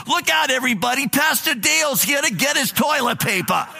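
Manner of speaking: shouting at the top of her lungs. Retold or imitated. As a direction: announce it to the whole aisle, hammed up. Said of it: "at the top of her lungs".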